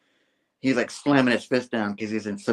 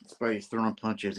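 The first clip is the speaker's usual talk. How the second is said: usual speaking voice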